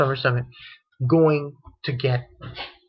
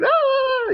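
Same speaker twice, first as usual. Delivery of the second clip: startled yelp; 4 dB louder than usual. Retold or imitated. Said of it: "It's like".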